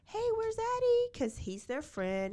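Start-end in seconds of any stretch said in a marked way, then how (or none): 0.1-1.1 high, loud friendly call; casual coworker. Retold or imitated. Coworker asks the killer about his friend, high friendly call. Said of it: "Capriccio was like".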